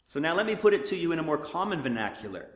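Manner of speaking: normal talking voice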